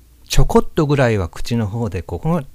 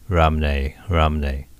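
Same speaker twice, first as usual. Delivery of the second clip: "ラムネ" in a lower English-speaker accent; foreigner's accent; 4 semitones lower, slower than usual. Shown as a demonstration. Teacher imitating foreigners, English-accented ラムネ. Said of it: "外人風に言うと".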